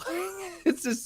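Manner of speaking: ordinary talk, nothing marked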